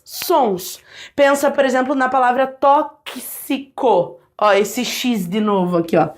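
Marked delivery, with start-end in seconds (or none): 2.5-4.2 TÓXICO slowly split, tok-si-co. Shown as a demonstration. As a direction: separate the k and s in TÓXICO. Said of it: "Esse kiss".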